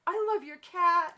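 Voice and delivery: put-on high, loud child's apology and gush; little girl correcting herself. Little girl apologising about the cat, high and loud. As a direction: squeal it like an eager kid. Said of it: "She's like".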